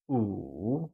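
Wu dipping low, then rising again; falling rising tone. Shown as a demonstration. Teacher modelling Wu with a low dipping tone for learners. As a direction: let Wu sink and curl back up. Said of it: "said with a falling rising tone".

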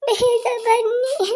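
high, very slow ಬೇಗ ಬನ್ನಿ, each word stretched out; drawn-out tearful plea. Acted. Child calls parents.

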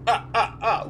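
counting vampire laugh; loud Count ah ah ah; Count Von Count. Acted. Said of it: "That's my Count Von Count impression".

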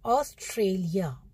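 ऑस्ट्रेलिया said slowly, each syllable clear; careful model word. Shown as a demonstration. Teacher modelling a word for the class to repeat, slow and clear. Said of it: "repeat करिए आप मेरे पीछे पीछे".

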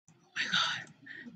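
slow, soft whispered oh my God. Real, not acted. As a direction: breathe it out in a hushed panic. Panicking over camera problem.

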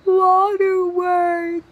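loud, high, drawn-out title reveal; showy announcement. Pretend. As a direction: stretch the title out big and loud. Announcing the third kid type.

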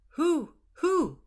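high hooting "Who, who?"; high owl calls in the night. Acted. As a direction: hoot twice like an owl.

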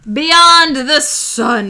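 announcing. Real, not acted. Announcing game title.

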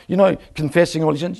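ordinary talk, nothing marked

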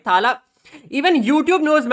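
normal talking voice

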